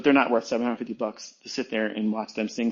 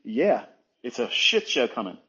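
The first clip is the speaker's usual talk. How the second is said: ordinary talk, nothing marked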